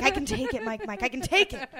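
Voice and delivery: insistent. Pretend. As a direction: plead it with mock bravado. Pleading with Mike Mike.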